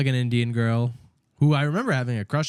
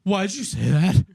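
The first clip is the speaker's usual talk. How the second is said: higher, louder mock-scandalized teacher; appalled teacher; 4 semitones higher, 5 dB louder than usual. Teacher turning on a student, appalled. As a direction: challenge it like an offended teacher. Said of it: "she turned around and was like".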